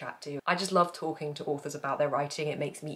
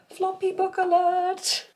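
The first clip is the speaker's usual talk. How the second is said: high, slow, playful announcer call; mock alert; 7 dB louder, slower than usual. Vlogger to viewers, showing a floppy book: hammed-up high alert call.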